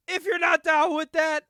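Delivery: retelling a wrestler's mic hype to the crowd, slow and loud; building hype call. Retold or imitated. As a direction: drag it out like a ring announcer hyping the crowd. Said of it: "and he says".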